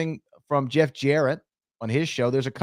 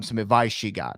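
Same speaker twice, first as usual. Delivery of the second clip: normal talking voice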